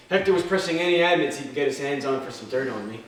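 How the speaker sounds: usual speaking voice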